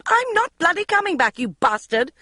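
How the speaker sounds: high, loud furious yell. Acted. Magda yelling at Jeremy, high and loud. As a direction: hurl it at him. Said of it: "Magda was yelling".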